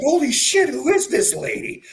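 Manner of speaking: high, stunned disbelief; like you can't believe it; amazed. Retold or imitated. Amazed inner thought.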